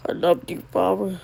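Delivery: ordinary talk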